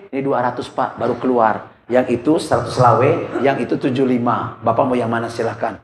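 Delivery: a hint of a meek stall seller; lightly voiced seller quoting her prices; young seller. Young seller answers the buyer.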